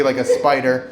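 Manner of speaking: ordinary delivery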